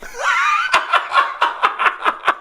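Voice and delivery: high shrieking laugh bursting out. Real, not acted. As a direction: cackle at your own punchline. Laughing at own story.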